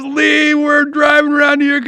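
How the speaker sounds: high-pitched excited holler down the phone; retold yelling. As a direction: call out excitedly, imitating a yelling friend. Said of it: "cops were yelling, and he was yelling".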